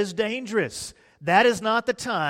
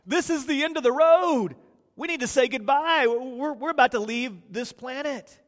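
much higher, louder mock alarm; hammed-up panic; 7 semitones higher, 5 dB louder than usual. Disciples waking Jesus in the storm, hammed-up panic. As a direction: play the terrified disciples, over the top. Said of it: "They're in a panic and they're like".

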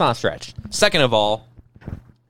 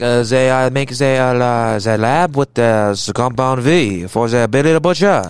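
put-on slow, halting French accent; drawn-out French accent, groping for words; Frenchie French accent; slower than usual. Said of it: "French is like".

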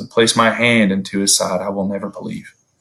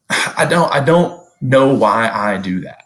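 ordinary talk, nothing marked

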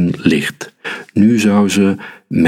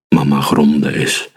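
plain reading voice

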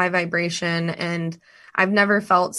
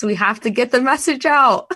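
excited; 6 semitones higher, 5 dB louder than usual. Real, not acted. Rallying partner to spread message.

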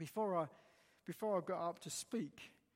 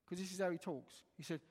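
normal talking voice

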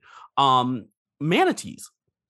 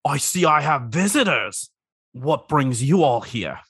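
snappy clicking crab; 4 semitones higher, 4 dB louder than usual. Acted. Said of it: "I thought my voice for him was better".

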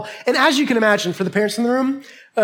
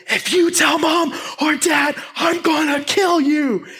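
high, strained shout at a brother; retold threat; 7 semitones higher than usual. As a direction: yell a threat on the run. Acted threat to his brother while running.

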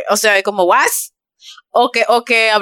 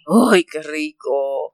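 savoring; 7 semitones lower, 4 dB quieter, much slower than usual. Real, not acted. Remembering tasty snacks.

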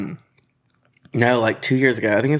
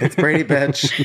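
sassy drag-queen reveal with a bit of attitude; 4 semitones higher, slower than usual. Pretend. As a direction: throw it out like a diva's reveal.

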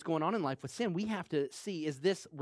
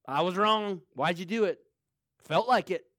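blunt sinner; 4 dB louder than usual. Retold or imitated. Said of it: "somebody that's a sinner say".

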